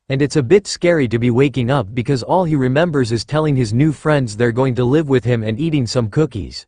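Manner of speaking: ordinary delivery, nothing marked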